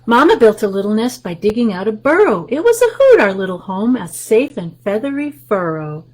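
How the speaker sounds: lively picture-book read-aloud; reading to children. Acted. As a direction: read the rhyme to the little ones.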